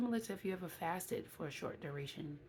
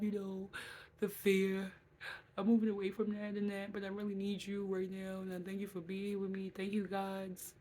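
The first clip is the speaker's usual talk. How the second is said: reliving a tearful thank-you to God, louder and higher; emotional retold prayer; 4 semitones higher, 4 dB louder than usual. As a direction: pour out gratitude and fear as if praying again, a touch overcome. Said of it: "I had an emotional breakthrough".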